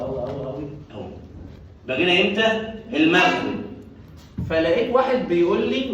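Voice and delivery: ordinary talk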